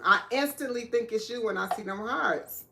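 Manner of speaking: ordinary delivery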